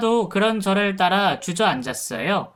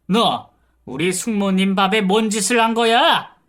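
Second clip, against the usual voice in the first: loud, higher furious yelling, slowing on the poison line; bellowing accusation; 4 semitones higher, 4 dB louder than usual. Retold or imitated. Nephew throwing the poison at the caregiver, furious bellowing. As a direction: roar it at her, waving the evidence. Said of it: "고래고래 고함을 치더군요".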